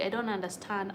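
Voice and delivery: usual speaking voice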